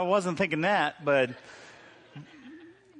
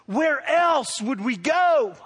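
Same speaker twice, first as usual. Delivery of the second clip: raised, slow, drawn-out cry; impassioned slow proclamation; 6 semitones higher, 5 dB louder, much slower than usual. Acted. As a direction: proclaim it slowly like the disciples' plea. Preaching the disciples' answer.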